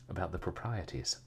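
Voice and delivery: plain reading voice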